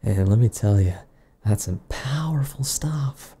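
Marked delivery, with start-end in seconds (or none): 1.5-3.4 whispering. Acted. Secretive aside after rant.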